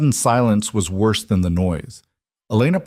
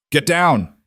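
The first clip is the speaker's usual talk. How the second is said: sharp shouted warning; shouting; 7 semitones higher than usual. A narrator's reading. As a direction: shout it high over the gunfire. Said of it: "he shouted".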